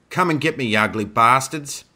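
loud, high challenge to the enemy; shouted taunt. A narrator's reading. Isabel draws the wolves away on horseback, shouted taunt. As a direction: goad them at the top of your voice.

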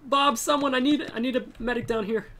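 urgent call. Real, not acted.

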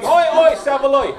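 hammed-up British Oi Oi chant; British accent. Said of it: "They're all pink, going".

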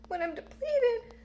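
barely holding it together; voice cracking high. Real, not acted. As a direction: pitch jumps up on the verge of tears. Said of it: "I get that emotional release as I'm crying".

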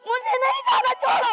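distressed refusal. Acted. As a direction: cry out in desperate refusal. Acted quarrel, girl refusing to go.

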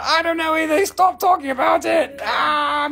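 a mock-desperate cry to drop the topic; laughing protest. Real, not acted. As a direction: shout it through the laughter. Begging chat to stop.

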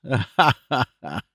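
puffing, snorting laugh. A narrator's reading. Panks laughs off Clennam's age with snorts. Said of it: "the wonderful laugh and series of snorts and puffs".

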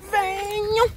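high cooing call to the dogs; high sing-song call. Real, not acted. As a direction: coax the dogs with a high lilting "Venham". Calling the dogs, repeated.

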